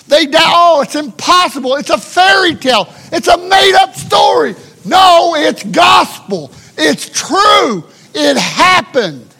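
loud, high, shouted preaching; preaching at full force. Acted. Preacher shouting down the doubters. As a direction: build to a roaring climax.

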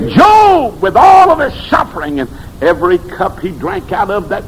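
belting out the climax of the sermon; preaching shout. Acted. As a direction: cry it out to the back pews.

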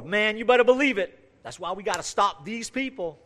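loud, high-pitched mock zeal; fired-up supporter. People respond to the preacher's point, mock zealous rallying cry. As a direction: imitate a riled-up believer rallying against others. Second fervent supporter acted out.

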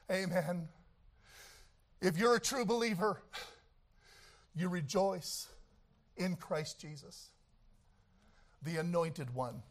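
quietly reverent; hushed reverence. Acted. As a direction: drop to a near hush after the storm. Hushed after the shouting.

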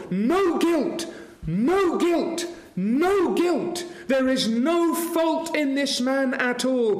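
Pilate shouting innocence three times; proclaiming shout. Acted. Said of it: "It's as if Pilate shouts three times".

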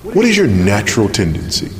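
ordinary talk, nothing marked

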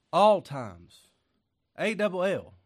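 loud, high, punched "All times. A double L."; emphatic shout. Real, not acted. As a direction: hammer "double" like a pulpit cry. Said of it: "I say that over and over and over again".

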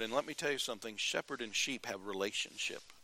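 usual speaking voice

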